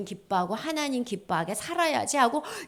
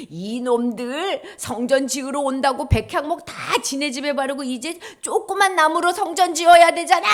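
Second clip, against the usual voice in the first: scolding; 6 semitones higher, 7 dB louder than usual. Acted. Imagined God scolding.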